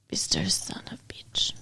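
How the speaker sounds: low, hushed mock Russian accent; murky, whispered fake Russian accent. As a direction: imitate a bad Russian accent under the breath. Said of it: "makes a lot of his lines very funny".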